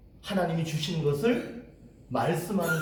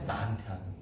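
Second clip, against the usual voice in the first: softer, as if murmuring into your own ear; quiet self-talk; 7 semitones lower, 8 dB quieter, much faster than usual. Shown as a demonstration. Pastor demonstrating talking to oneself, quietly. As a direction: say it quietly to yourself. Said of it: "자기 귀에다 대고 얘기하고 있어요".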